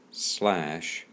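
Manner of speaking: normal talking voice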